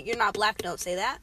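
a hint of a harsh rebuke; mock harsh scold. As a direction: snap it at them. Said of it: "they get more offended when you say".

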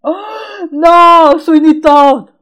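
excited shout. Real, not acted. Excited recognizing favorite film.

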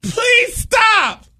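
high mock shriek of begging; desperate pleading. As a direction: cry out as if being drowned.

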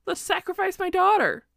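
high, loud mock-eager announcement; mock excitement. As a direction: blurt it out high and loud like a brilliant idea. Mock king's eager bright idea.

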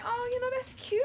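high, sweet and pleased; cutesy high voice. Retold or imitated. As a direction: coo it like you're touched. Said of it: "I'm like".

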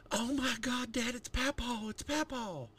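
put-on high childlike excited voice; a five-year-old thrilled to see her grandpa; excited little girl.

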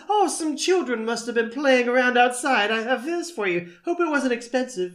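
high, lilting nanny voice; Missus Doubtfire. Acted. Nanny handing over the broken car ornament, innocent falsetto. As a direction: put on a falsetto old nanny. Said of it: "Oh, Missus Doubtfire".

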